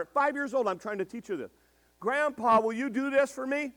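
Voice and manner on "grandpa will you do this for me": mock little girl. Voicing granddaughter.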